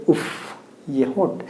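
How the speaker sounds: soft, low, swooning उफ, ये होंठ; admiring sigh. Shown as a demonstration. Teacher acts out swooning over lips, softly. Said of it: "तो हम लोग क्या कहेंगे?".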